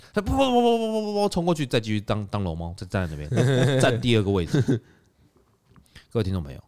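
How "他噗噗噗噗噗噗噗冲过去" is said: high, rapid putt-putt noise; mock putt-putt sound. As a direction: sound out her dash. Imitated rushing sound. (高声模仿冲过去的噗噗声)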